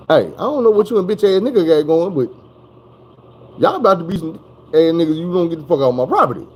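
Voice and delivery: high, loud, firm eviction; mock mother throwing buyers out; mama kicking out. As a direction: order them off the property. Mama orders them out.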